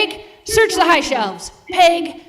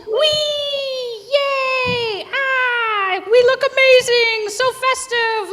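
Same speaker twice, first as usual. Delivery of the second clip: high, squealing party eggs; excited squeals; 8 semitones higher, much slower than usual. Acted. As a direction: squeal with delight like giddy eggs.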